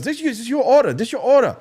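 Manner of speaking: squeaky, high put-on Chinese accent, hammed up; mock Chinese accent. Host imitating the drive-thru cashier, high mock Chinese accent. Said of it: "enough with the racist jokes".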